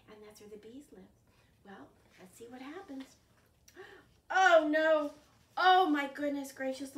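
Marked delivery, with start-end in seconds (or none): 4.3-6.9 loud exaggerated oh no; mock alarm. Storyteller to children, loud hammed-up dismay. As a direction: ham up the shock for kids. Wagon rolling downhill.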